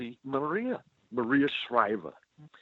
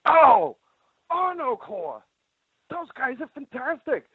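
put-on high-pitched gushing young fan; excited kid; 12 semitones higher than usual. Kid running up to Maria, high excited gushing. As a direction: squeal it like an eager twelve-year-old. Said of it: "this kid runs up and he goes".

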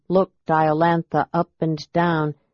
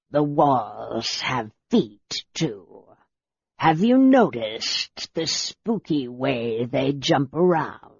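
much lower, very slow reptilian growl; a scaly soldier grumbling low and slow; lizard man voice; 4 semitones lower, slower than usual. Acted. Draconian commander speaks.

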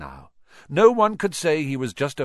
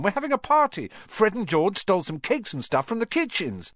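high, louder eager chatter; excited Ron; 9 semitones higher, 3 dB louder than usual. A narrator's reading. Ron telling Harry the match news excitedly.

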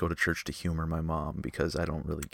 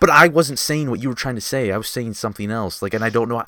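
loud, exasperated arguing; heated yelling; 6 semitones higher, 10 dB louder, faster than usual. Real, not acted. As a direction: insist loudly, frustrated at being misunderstood. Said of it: "me and Nolan yelling".

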